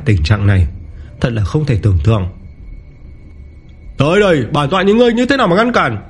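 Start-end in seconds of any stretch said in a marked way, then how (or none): 4.0-6.0 high-pitched, indignant dare; angry challenge. A narrator's reading. Rival challenges the hero to withstand his blow, angry and high. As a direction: climb high and dare him to block you. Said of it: "Tiểu Ba Thiên tức giận".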